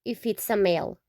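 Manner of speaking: ordinary delivery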